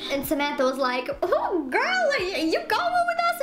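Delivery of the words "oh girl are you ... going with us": high-pitched mock girlfriend voice; acted friend's question. Friend asks if she's leaving with the group, high acted incredulity.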